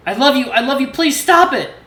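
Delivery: high, loud sobbing plea; frantic pleading. A narrator's reading. Son begs his father, frantic high plea. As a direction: plead with all you have.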